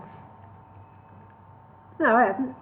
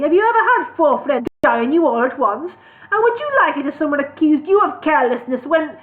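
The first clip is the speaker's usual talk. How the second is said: higher, louder furious protest; angry dwarf; 6 semitones higher, 6 dB louder than usual. Acted. Dwarf angrily confronting elf lord, louder. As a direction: rail at the elf.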